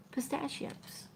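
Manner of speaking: Pistachios whispered without voice, to herself. Real, not acted. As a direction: breathe Pistachios out as a private realization. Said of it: "trying to think of what kind it was".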